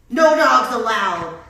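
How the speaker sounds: very loud, slow, stretched yell. A narrator's reading. Cactus bans the coyote, yelling. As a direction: yell the rule at an intruder. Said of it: "Hank yelled".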